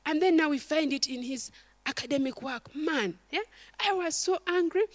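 ordinary talk

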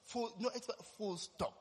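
ordinary delivery

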